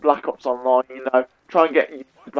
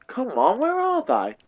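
swooping up very high; high, whiny searching call; exasperated playful call; 8 semitones higher, 4 dB quieter, slower than usual. Pretend. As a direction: let the pitch leap up in mock exasperation. Calling for missing zombies.

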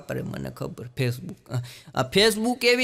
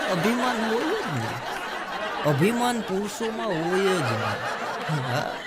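laughing; 4 dB quieter, much slower than usual. Real, not acted. Laughing aside to audience.

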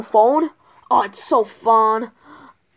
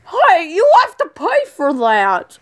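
high, slow protest called after thieves; indignant calling out; 7 semitones higher, 6 dB louder than usual. Acted. Clerk calls after fleeing customers, high indignant shout. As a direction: call after them, stretching the words.

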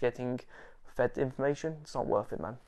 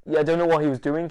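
ordinary talk, nothing marked

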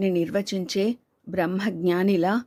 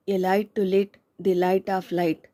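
slow recital; much slower than usual. Acted. Poem tribute read at ceremony.

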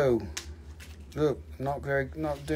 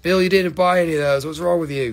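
ordinary talk

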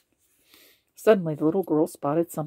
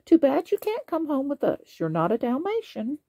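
higher, gently regretful; a hint of a kindly nanny letting him down; lightly voiced nanny; 8 semitones higher than usual. A narrator's reading. Nanny tells Lucky he can't come, lightly voiced. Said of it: "she said to Lucky".

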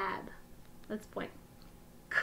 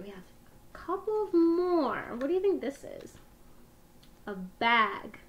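ordinary delivery

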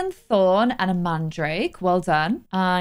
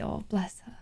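whispered aww, bless her; tender whispered aside; soft whisper; 13 dB quieter than usual. Real, not acted. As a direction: breathe it out fondly. Cooing at sim trying for baby.

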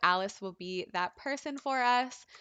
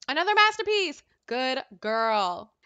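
excited cheer; 3 semitones higher, 6 dB louder than usual. Pretend. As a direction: celebrate it in a big raised voice. Cheering Sim's finished painting.

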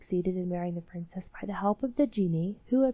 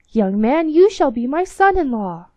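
loud, higher joyful proclamation; the king proclaims it gladly; crying out; 5 semitones higher, 10 dB louder than usual. Acted. King welcomes the captain as son-in-law, loud joyful cry. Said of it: "cried the king".

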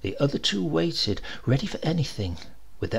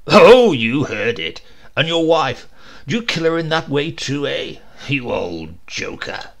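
mocking tease; 6 dB louder than usual. A narrator's reading. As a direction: rib him mercilessly. Brigadier teases through laughter.

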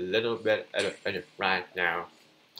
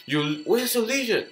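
mock taunt, high; 11 semitones higher, 3 dB louder than usual. Real, not acted. Taunting opponent again.

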